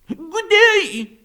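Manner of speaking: very high, loud squeak of a greeting; a tiny excited critter says hello; squeaky raccoon. Acted. Lumpkin greeting the group in a very high squeak.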